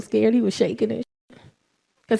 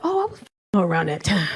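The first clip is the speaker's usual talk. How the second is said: lower, flat dawning realization; 7 semitones lower than usual. Retold or imitated. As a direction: mutter it as the guilty son. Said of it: "was like".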